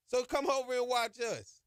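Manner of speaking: usual speaking voice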